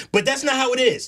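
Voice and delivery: usual speaking voice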